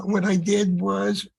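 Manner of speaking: normal talking voice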